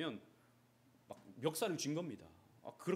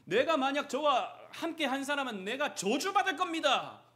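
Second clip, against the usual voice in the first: higher and louder, vehement; fierce denial; 11 semitones higher, 10 dB louder than usual. Acted. Peter denying Jesus to accusers, fierce vehement denial. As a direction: deny it with all your force. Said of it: "아주 격하게 부인합니다".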